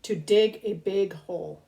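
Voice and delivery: lilting read-aloud storybook voice; reading to children. Acted. Host reads a rhyming book to kids, storybook lilt. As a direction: read each rhyme slowly to little ones.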